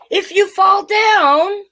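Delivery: storybook drama; dramatic reading. Acted. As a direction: play up the fall.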